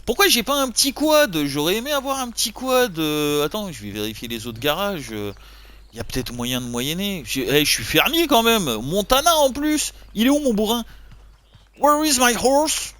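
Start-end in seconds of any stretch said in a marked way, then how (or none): 0.0-1.4 loud, high hype call to the chat; real excited gamer yell; hyped shout. Real, not acted. Hyped gamer rallying his viewers. 11.8-13.0 mock American cowboy. Playing a Montana farmer.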